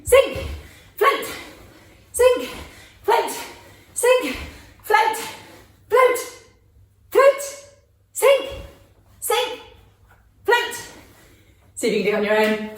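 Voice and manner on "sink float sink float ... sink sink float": quick called commands. Shown as a demonstration. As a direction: snap each command out fast. Fast calling commands game.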